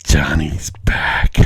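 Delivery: low, slow spooky horror whisper; creepy whisper. Pretend. Spooky horror movie line.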